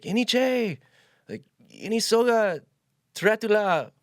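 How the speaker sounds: high-pitched hail to someone at a distance; calling out. Retold or imitated. Asking a farmer the way, calling out. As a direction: call each foreign word out high and loud.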